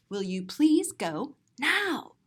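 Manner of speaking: ordinary delivery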